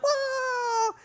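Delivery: squealed whoa, far up in the voice; a sudden high cry of wonder; showing off a new trick with a squeal. Retold or imitated. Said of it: "I was like".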